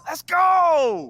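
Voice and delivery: loud, high cheer with a laugh; excited shout. Acted. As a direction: whoop it out in triumph. Celebrating news of starting.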